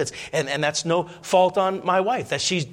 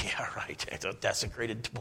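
scoffing whisper; 6 semitones lower, 12 dB quieter than usual. Retold or imitated.

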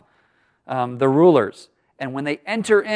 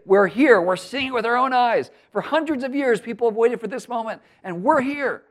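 higher, awed voice of the waiting people; thrilled crowd; 8 semitones higher than usual. Retold or imitated. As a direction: speak as someone finally seeing the promise come.

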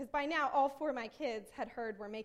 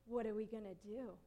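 put-on soft, lowered child's question; hushed imitation; 4 semitones lower, 6 dB quieter than usual. Daughter watching mom bang around, asking quietly. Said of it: "Like that, yeah".

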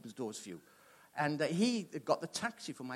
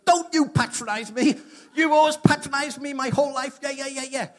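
much higher, louder, ranting brother; high, loud hammed-up rage; mock angry brother; 10 semitones higher, 11 dB louder than usual. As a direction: throw the drunk brother's tantrum. Brother's angry outburst retold.

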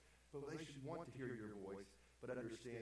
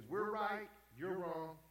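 louder, emphatic mock verdict; overbearing parent; 8 semitones higher, 8 dB louder, much slower than usual. Preacher mimicking parents who won't let children talk, overbearing. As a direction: lay down the law as an imitated parent.

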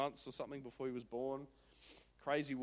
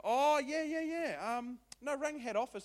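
1 cheerful seller; 10 semitones higher, 5 dB louder than usual. Retold or imitated. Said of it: "they said".

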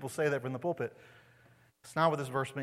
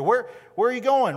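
loud, high, pleading call to the Lord; urgent cry; 8 semitones higher, 8 dB louder than usual. Acted. Disciples asking the Lord, a loud pleading cry. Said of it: "the disciples tell us here".

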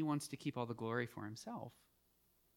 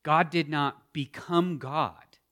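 ordinary talk, nothing marked

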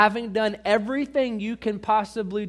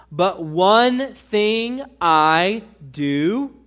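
"but one thing I do" said very slowly and much louder, each word hit; slow, heavy stress on "one thing"; emphatic stress; 8 dB louder, much slower than usual. Shown as a demonstration. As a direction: lean hard on "one thing I do", drawing it out. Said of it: "notice carefully the emphasis I put on the right syllable".

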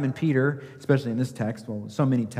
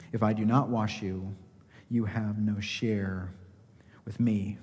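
hushed; 4 semitones lower, 6 dB quieter than usual. Shown as a demonstration. Preacher quietly echoing Jesus' answer to Peter, fading to a whisper. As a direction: repeat it softer, fading to a whisper on "you have no share with me".

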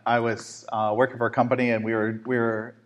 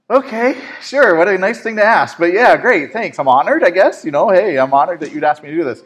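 high, loud, hammed-up flustered reply; flustered acceptance; 7 semitones higher, 7 dB louder than usual. Employee retelling his reply to boss's funeral request, flustered mock acceptance. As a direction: gush an awkward yes, pitched way up.

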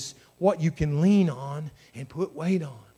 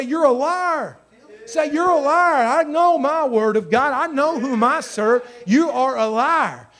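loud, high preaching shout; defiant rebuke; 7 semitones higher, 6 dB louder than usual. Acted. Believer talking back to the devil, defiant shout. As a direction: throw the accusation out with full force.

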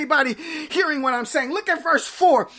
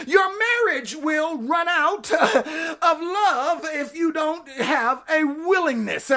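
ordinary delivery

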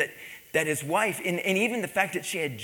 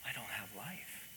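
very soft, lowered voice; hushed realization; 4 semitones lower, 17 dB quieter than usual. Retold or imitated. Husband confessing to the pastor after sleepless nights, hushed. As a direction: let it sink to a near murmur.

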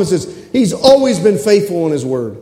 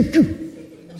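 normal talking voice

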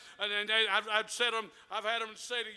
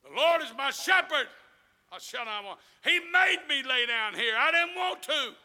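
loud, higher preaching call; loud scripture preaching; 4 semitones higher, 5 dB louder than usual. Acted. Preacher proclaiming the psalm to the congregation. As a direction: proclaim it to the church.

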